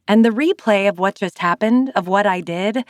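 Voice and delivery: usual speaking voice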